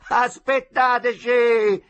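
loud, high, slow call. Acted. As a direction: call across the river. Boys calling to the boat. (richiamo da lontano)

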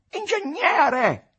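high, loud drawn-out call; calling after someone. A narrator's reading. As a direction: call him back sharply from behind. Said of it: "lo richiamò Capolino". (richiamo ad alta voce)

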